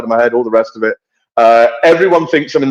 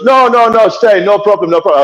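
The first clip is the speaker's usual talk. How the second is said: retold insistence; 7 semitones higher, 3 dB louder than usual. Retells man grabbing his keys.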